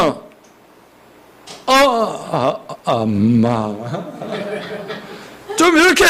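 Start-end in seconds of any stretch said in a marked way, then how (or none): 0.0-3.7 low, slow and stretched out; slow drawn-out. Shown as a demonstration. Pastor showing the slow patient way to call mom. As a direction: drag the anger out slowly. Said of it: "천천히 늘어지게".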